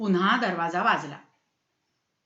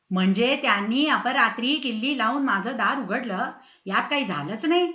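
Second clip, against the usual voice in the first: usual speaking voice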